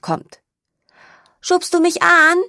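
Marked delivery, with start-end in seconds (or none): 1.4-2.4 much higher, louder little piglet voice; a small piglet begging for a push on the swing; piglet Mo asking. A narrator's reading. Mo asks his sister to push him. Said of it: "fragt Ferkel Mo".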